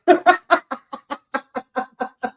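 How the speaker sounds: high, helpless laughter; long high-pitched laugh. Real, not acted. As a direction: crack up in a long run of giggles. Laughs at being sent home.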